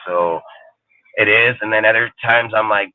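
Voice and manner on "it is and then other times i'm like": ordinary talk, nothing marked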